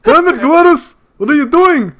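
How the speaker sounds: high-pitched exasperated old grandparent, hammed up. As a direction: scold your spouse like an old New Yorker.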